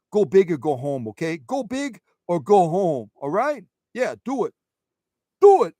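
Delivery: hammed-up Brooklyn accent cheer. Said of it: "Say it with a Brooklyn accent".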